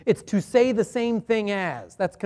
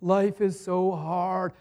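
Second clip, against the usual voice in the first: slow, mopey sigh of self-pity; self-pity whine; 4 dB quieter, slower than usual. Retold or imitated. A preacher imitating a self-pitying sinner, slow and mopey. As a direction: wallow in it, mock whiny. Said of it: "wallowing in self-pity".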